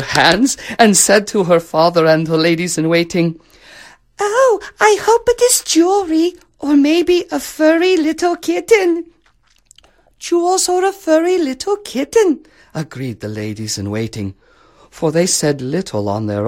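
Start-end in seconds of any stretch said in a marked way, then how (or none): 4.2-9.1 put-on very high, girlish princess voice; eager little princess. Princess hoping for gifts, excited high voice. 10.2-12.5 very high chorus-of-ladies voice; echoing ladies-in-waiting. Acted. Ladies-in-waiting agree with the princess, high echoing voice.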